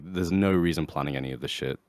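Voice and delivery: normal talking voice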